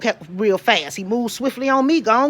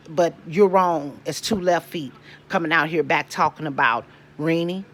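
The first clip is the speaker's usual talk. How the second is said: lower, softer closing words; quiet resigned; 6 semitones lower, 6 dB quieter than usual. Real, not acted. Tired mother closing her rant, lower and softer. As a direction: drop it down and let it go.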